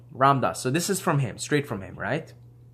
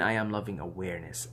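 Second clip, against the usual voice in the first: softer, lower, inward; soft inner affirmation; meditative; 5 semitones lower, 8 dB quieter than usual. Shown as a demonstration. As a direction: say it quietly to yourself. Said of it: "and then start saying".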